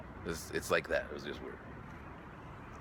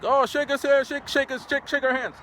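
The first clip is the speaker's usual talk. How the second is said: put-on high, loud teasing boys; mock the boys begging, high; high loud boyish pleading; imitating schoolboys; 13 semitones higher, 4 dB louder than usual. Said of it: "they're like".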